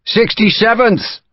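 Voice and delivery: high, slow, far-off answering shout; shouted reply. Acted. Distant soldier shouting back his regiment. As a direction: call back across the camp. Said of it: "a voice shouted back".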